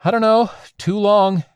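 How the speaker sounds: high, whiny complaint; whining. A narrator's reading. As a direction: whine it out, fed up. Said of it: "the whining in her voice".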